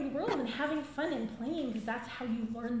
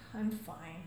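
low, subdued not-fine answer; unconvincing fine; 7 semitones lower, 4 dB quieter than usual. Retold or imitated. Friend asked if okay, low and unconvincing. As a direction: say fine while clearly not fine. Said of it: "the tone of their voice".

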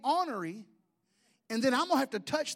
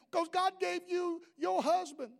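higher, put-on woman's voice; mock lady voice; 9 semitones higher, slower than usual. Jealous lady apologizing to Sherry, higher mock voice.

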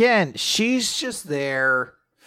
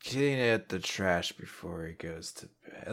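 slow, sleepy mumble fading away; nodding off; 3 semitones lower, slower than usual. Retold or imitated. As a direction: start dazed, then trail off as if passing out. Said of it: "Like, just passing out".

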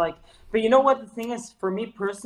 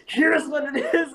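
higher, louder mock-harsh declaration, laughing through it; harsh, demanding tone; 8 semitones higher, 6 dB louder than usual. Host mocking his own harsh posts, higher and louder. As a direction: imitate your own bossy know-it-all voice. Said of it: "I sounded to myself, like, really harsh".

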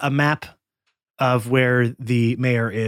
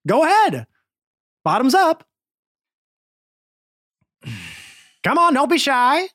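much higher, louder huckster voice; slick salesman; 13 semitones higher, 5 dB louder than usual. Acted. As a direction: call out like a carnival pitchman. Scam Likely urging drink.

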